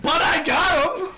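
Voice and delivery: high, loud, slow proud yell; triumphant shout. Retold or imitated. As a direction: bellow it like you won the argument. Sergeant defends his catch.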